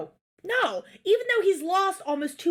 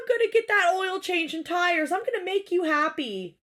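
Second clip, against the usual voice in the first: higher-pitched imitation of a pleading husband; mock crying; 6 semitones higher than usual. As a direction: beg like he's tearfully promising, mockingly. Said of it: "he's, like, crying to her".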